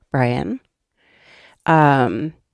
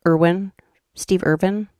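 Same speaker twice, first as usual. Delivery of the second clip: ordinary talk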